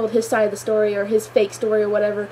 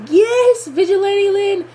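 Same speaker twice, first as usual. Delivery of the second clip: high, louder fan cheer; excited cheer; 8 semitones higher, 3 dB louder, slower than usual. Retold or imitated. Fan cheering Lin going rogue, high loud cheer. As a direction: cheer her on like a hero's entrance. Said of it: "I'm like".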